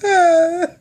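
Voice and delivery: high, loud put-on wail; fake sob. Starting the scam on the manager, fake sobbing. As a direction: burst into mock tears. Fakes crying to scam him.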